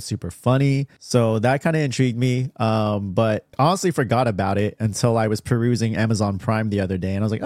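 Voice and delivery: normal talking voice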